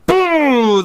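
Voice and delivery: a sharp high cry of triumph; excited. Real, not acted. Gamer celebrating the enemy destroyer sinking, triumphant. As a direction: celebrate the kill.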